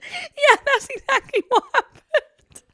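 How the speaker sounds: slow, high squeaky laugh-talk; cracking up; laughing hard. Real, not acted. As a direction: force the words out through laughter. Helpless laughter during podcast.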